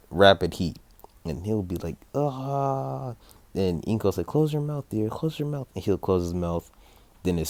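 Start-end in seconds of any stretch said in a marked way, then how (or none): 2.1-3.1 long, higher held ah; open-mouthed cry. Acted. Izuku as fire pours from his mouth, held cry. 4.3-5.7 high-pitched, fussing mom voice; urgent mother. Acted. Inko to her fire-breathing son, urgent motherly plea.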